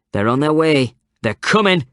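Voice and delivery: high, loud shrill call; shrill woman piping up. A narrator's reading. Second woman outside backing her up, high and shrill. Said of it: "another shrill voice added".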